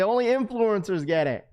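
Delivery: high mocking voice of complaining chatters; high mocking complaint; mocking whiny chat. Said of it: "That's what people are gonna say".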